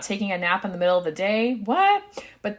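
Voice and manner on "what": high, loud incredulous yelp; mock disbelief. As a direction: pretend to be astonished, pitch shooting up. Mock disbelief at napping midday.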